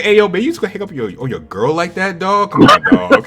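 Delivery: teasing taunt. Retold or imitated. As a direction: rattle it off then crow the last words. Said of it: "I was like".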